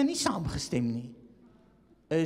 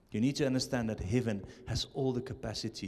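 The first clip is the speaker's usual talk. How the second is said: normal talking voice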